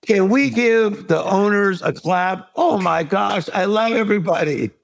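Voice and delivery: bright, high showman greeting to the crowd, hiding the pain; high, cheery host voice while injured; charismatic stage persona. Retold or imitated. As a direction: beam at the audience, big and upbeat. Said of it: "I was charismatic up here".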